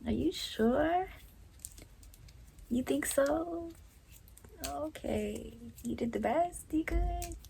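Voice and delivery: high, slow, mocking hum of doubt; sarcastic disbelief. Real, not acted. To mom claiming she did her best, high mocking disbelief. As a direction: drag it out, not buying it.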